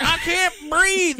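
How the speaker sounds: high, slow, loud hammed-up gasping line; mock panic. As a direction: wail like a drama queen. [name] voiced panicking.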